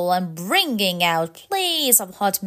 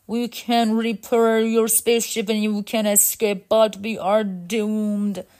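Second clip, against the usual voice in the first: level robot voice, slowing at the end; resigned mechanical leader. Acted. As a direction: pronounce doom as a robot elder.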